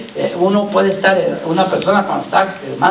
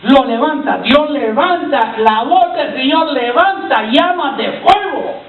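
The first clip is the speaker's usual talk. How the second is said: raised, drawn-out preacher's proclamation; fervent pulpit exhortation, pitched up; impassioned preaching; 5 semitones higher than usual. Acted. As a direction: build it into a ringing call to the congregation. Preaches at full force.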